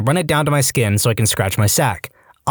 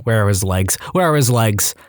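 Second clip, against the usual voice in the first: ordinary delivery, nothing marked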